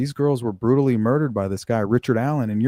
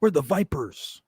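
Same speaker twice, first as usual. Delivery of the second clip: lightly voiced tough guy, a bit higher; a hint of a bragging biker gang member; tough biker quote; 7 semitones higher than usual. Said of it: "and they say".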